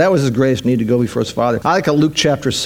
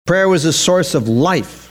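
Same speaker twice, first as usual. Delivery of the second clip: normal talking voice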